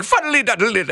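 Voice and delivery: high-pitched cartoonish Irish lilt; hammed-up mock Irish, pitched high; leprechaun-y Irish accent. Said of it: "It was very leprechaun-y".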